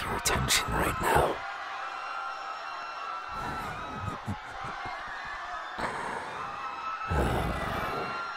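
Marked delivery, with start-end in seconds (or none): 1.4-7.0 high unhinged demon cackle; evil laugh. Acted. Demon celebrates siding with the victor, high evil cackle. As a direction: cackle like you already won.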